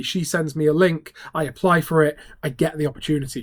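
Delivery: ordinary talk, nothing marked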